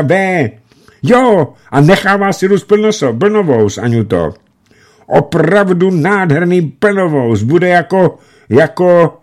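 high, loud, tumbling words; hurried excited shouting. A narrator's reading. Meresjev reassuring Aňuta over the phone, shouting excitedly. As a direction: blurt it all out fast and happy. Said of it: "křičel překotně do sluchátka".

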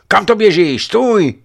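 high, loud yell to stop; alarmed shout. A narrator's reading. Amosov yells after the running boy, alarmed shout.

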